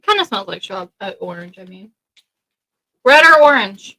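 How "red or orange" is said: loud question. Real, not acted. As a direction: call out the choice. Offering candy, loud question.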